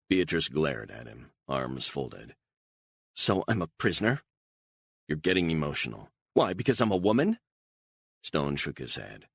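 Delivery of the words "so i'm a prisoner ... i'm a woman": quick and high, bristling; sharp retort. Acted. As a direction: fire back fast, offended. Beatrice bristles at Stone.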